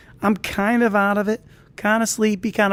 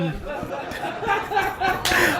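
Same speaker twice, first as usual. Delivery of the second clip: a real high burst of laughter at his own story; 11 semitones higher, 6 dB quieter than usual. Real, not acted. Laughing at his own story, a high giggle. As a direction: let a high giggle break out.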